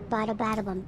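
ordinary talk, nothing marked

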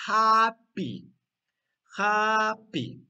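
loud, high "Happy" in two long beats; stretched, emphatic "Happy"; model answer. Shown as a demonstration. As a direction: belt out "Happy" for the class. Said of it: "¿cómo está?".

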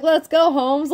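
mock fired-up challenge; hyped challenge. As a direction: pump yourself up for a fight. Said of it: "You're like".

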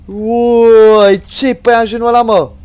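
loud high alarmed cry; loud frightened outburst; startled alarm. Real, not acted. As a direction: blurt it out in fright. Sees giant spider.

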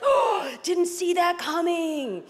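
gasping, slow, high mock surprise; gasp, then stretch out the astonishment; surprised. Viewer caught out by fast action, in hammed-up surprise. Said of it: "you're surprised".

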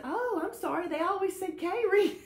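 high-pitched mock apology; retold flustered apology. Speaker apologises for getting a name wrong, high and flustered. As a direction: voice jumps right up, apologising. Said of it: "I said".

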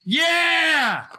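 very high, loud whoop; triumphant yell. Real, not acted. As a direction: shout for joy at the hit. Win on a slot.

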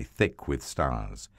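ordinary delivery

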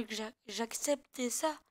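ordinary talk, nothing marked

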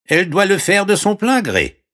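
very high frog voice; squeaky-high frog offering help; talking frog. Acted. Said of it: "dit la grenouille". (voix de grenouille très aiguë)

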